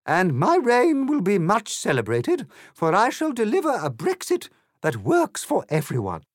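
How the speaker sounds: high, loud grandiose queen voice; haughty Queen. A narrator's reading. Queen boasts to Alice about her reign, grandiose. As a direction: proclaim like a self-satisfied monarch.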